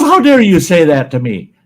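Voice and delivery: high, loud indignant shriek, laughing through it; mock outrage. Teller voicing the insulted lady, high and loud with mock outrage. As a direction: play the offended lady with a giggle.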